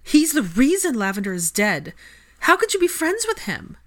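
loud accusation climbing very high; anguished, high-pitched outburst; rising tearful anger. A narrator's reading. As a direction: cry out at a friend's betrayal. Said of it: "Her voice started to rise, and the tears began".

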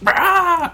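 sudden high, loud screech; mock blast scream. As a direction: imitate a laser blast with a yell. Imitating a laser vision blast.

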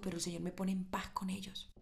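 whispered softly. Real, not acted. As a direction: drop to a hushed confiding whisper. Preacher drops to whisper.

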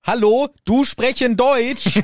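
high, loud, slow, over-simple German; German talking down to foreigners. Retold or imitated. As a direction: speak slowly to a foreigner as if they were dim. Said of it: "So wie Deutsche mit Ausländern".

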